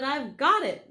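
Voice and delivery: loud, triumphant cry; excited shout. Acted. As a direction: burst out with the idea. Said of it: "he jumped up and shouted".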